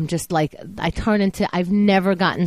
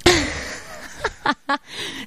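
amused laugh; 8 semitones higher, 4 dB quieter than usual. Real, not acted. After friend's joke about a break.

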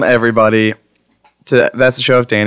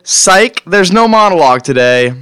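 playful gotcha; 6 semitones higher, 4 dB louder than usual. Acted. Fake-out joke.

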